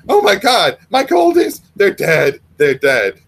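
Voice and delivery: high, loud cry of horror; frantic panic. Acted. Missus Miller finds her goldfish dead, screaming in panic. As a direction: shriek it out, devastated.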